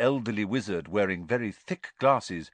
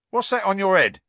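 sudden loud, high question; abrupt; 12 semitones higher, 10 dB louder than usual. A narrator's reading. Stan suddenly spots the scar on Harry, abrupt. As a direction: snap the question out. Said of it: "said Stan abruptly".

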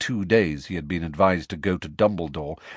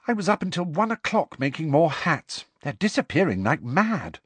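put-on a touch higher girl's voice; lightly voiced young girl; 8 semitones higher than usual. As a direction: read it as a chatty schoolgirl. Hermione voiced by narrator.

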